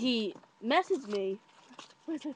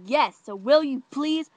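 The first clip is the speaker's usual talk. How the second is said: louder, slower, higher plea; insistent pleading; 4 semitones higher, 7 dB louder, slower than usual. Retold or imitated. Boy asking her to go out, insistent. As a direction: push the request with impatience.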